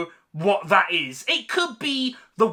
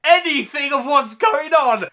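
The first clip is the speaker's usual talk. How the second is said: loud, high exasperated punchline; exasperated shout; 5 semitones higher, 5 dB louder than usual. Real, not acted. Reviewer mocking the all-orange screen, loud exasperated shout. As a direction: shout the answer you already hate. Ranting about orange colour grading.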